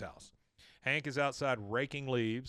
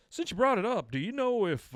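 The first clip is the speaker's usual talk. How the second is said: coaxing; 9 semitones higher, 5 dB louder than usual. Retold or imitated. Hank fishing for information.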